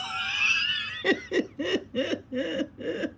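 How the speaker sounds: high, soft, trailing laughter; helpless laughter. Real, not acted. Friend remembering the dentist video call, helpless laughter. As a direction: laugh high until it fades out.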